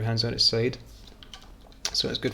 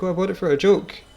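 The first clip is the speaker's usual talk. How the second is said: higher, louder mock protest; feigned excuse; 7 semitones higher, 5 dB louder than usual. Telling people in pubs why they got one, feigned excuse. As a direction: play up a breezy cover story. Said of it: "I'd be like".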